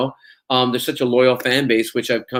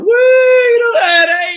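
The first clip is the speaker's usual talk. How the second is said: very high, loud whooping; cheering crowd; 8 dB louder, slower than usual. Retold or imitated. Retelling Glasgow fans cheering his introduction, cheering crowd. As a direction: whoop like a rowdy audience.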